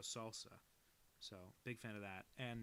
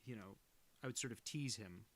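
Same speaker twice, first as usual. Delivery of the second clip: usual speaking voice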